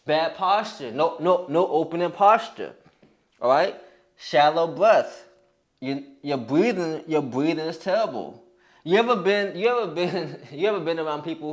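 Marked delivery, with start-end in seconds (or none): none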